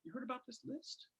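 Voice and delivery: sly hushed gossip; hushed question. Retold or imitated. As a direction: lean in and ask it on the sly. Acting him asking around.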